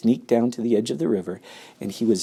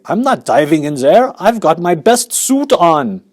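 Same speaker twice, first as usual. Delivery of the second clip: fussy father; 8 semitones higher, 7 dB louder than usual. Acted. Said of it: "said Mister Gloop".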